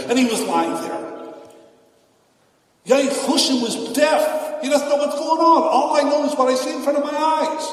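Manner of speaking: high, loud preaching at full force; fiery sermon. Acted. Rabbi rousing his congregation about getting used to anything, fiery. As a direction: drive the lesson home with rising fervor.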